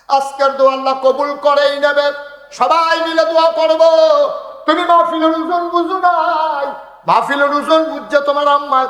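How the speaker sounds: high, loud, sustained chanting cry; full-force preaching. Real, not acted. Preacher urging the crowd to join the prayer, full-force chanting.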